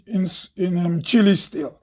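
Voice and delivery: ordinary talk, nothing marked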